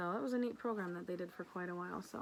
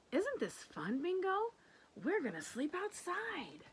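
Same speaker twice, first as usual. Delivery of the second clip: put-on excited child voice; 10 semitones higher than usual. Lulu excited about camping.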